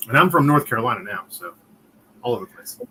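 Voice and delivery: ordinary delivery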